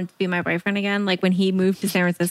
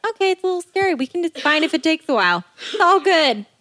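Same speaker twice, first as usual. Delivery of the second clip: much higher mock-calm voice; self reassurance; 8 semitones higher than usual. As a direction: talk yourself down, up high. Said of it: "I'm like".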